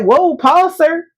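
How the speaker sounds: high, firm whoa; wife halting her husband, high and sassy; sassy stop. Retold or imitated. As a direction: pull him up short. Wife stopping husband mid-argument.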